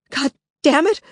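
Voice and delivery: Lily shouting at intruders in her house, shocked. Acted. Shocked shout. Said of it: "Lily shouted at them".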